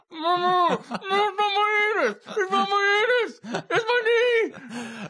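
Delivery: high, wailing, crying protest; mock panicked crying. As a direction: wail it like a panicking patient. Patient panics going under anesthesia.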